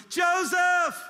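high, loud call across a distance; full-voiced calling-out, name stretched; retold yell. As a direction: yell out for a lost child. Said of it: "And I yell at him".

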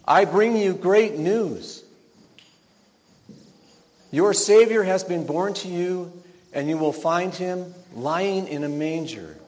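high, loud heralding, easing toward the end; bold but comforting. Acted. Angel brings shepherds news of the Savior, bold but comforting. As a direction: proclaim it boldly to comfort them. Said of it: "bold but comforting".

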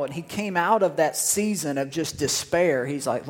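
usual speaking voice

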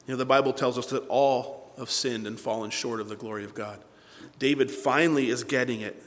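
ordinary delivery

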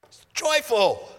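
high, loud, drawn-out exclamation; joyful outburst. Real, not acted. Preacher celebrating God's promise in prayer, joyful outburst. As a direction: burst out with loud joy.

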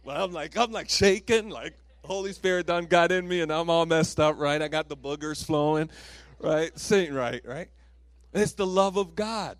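loud, emotional preaching climb; preacher overcome, shouting about the love of God; fired-up preaching. Real, not acted. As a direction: build it loud with feeling. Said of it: "trying to do the man thing, not cry".

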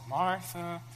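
slow, calm and gentle; calm Jesus. Acted. Preacher voicing Jesus calmly answering an upset Martha. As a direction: ease each word out, soothing. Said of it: "And Jesus calmly".

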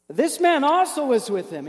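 put-on accusing servant girl. Servant girl calls out Peter by the fire, accusing. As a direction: point the finger, loud and high.

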